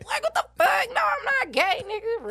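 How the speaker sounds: high, loud acted outburst; shocked denial. Acting out a skit's denial to the interviewer, shocked and loud. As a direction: retell it as an outraged, high-pitched protest.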